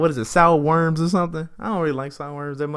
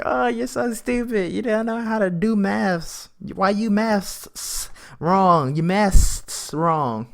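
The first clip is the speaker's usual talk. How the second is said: high, slow, mocking commenter voice; 7 semitones higher, 3 dB louder, much slower than usual. Imagined commenters mocking his math, slow and high. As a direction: stretch it out like a smug troll. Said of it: "I hope everybody in comments like".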